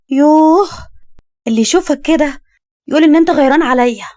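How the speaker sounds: high, loud huffy protest; huff, then needle him; exasperated teasing. Acted. Young woman teases him as jealous.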